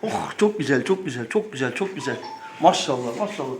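soft, low cooing; soothing. Real, not acted. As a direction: gently soothe the bird. Soothing bird back in place.

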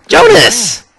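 high loud call across the room. Pretend. Player hails a character with a loud high call. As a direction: shout the name to get his attention.

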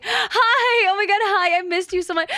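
high, loud happy hello; gushing high-pitched greeting; excited squeal. Real, not acted. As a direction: squeal with delight at a friend.

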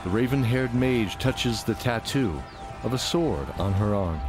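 normal talking voice

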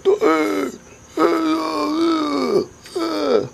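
high, quavering old man imitation, stretched out; mock the old man yelling across the balconies. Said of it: "That's more like it".

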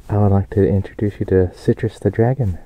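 ordinary delivery

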